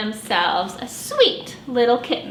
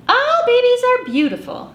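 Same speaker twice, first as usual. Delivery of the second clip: firm and higher pitched; 11 semitones higher, 3 dB louder than usual. Acted. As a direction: state it firmly like a fond mother. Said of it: "said Missus Deer firmly".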